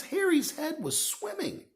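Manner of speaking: usual speaking voice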